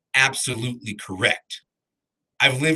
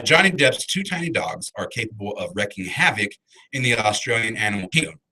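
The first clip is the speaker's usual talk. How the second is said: usual speaking voice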